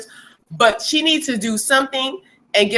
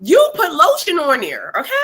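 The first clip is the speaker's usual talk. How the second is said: mock screaming mom; 8 semitones higher than usual. Britney yelling at her kids to use lotion, shrill and crying. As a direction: wail at the kids like a mother at her limit. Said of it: "I guess coarse is ashy".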